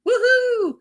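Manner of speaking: high, excited whoop; celebratory high-pitched woohoo; cheer. Real, not acted. Cheering the trip countdown.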